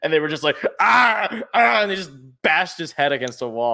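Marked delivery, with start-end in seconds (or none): 0.8-1.8 mock pained cry. Players voiced being crushed.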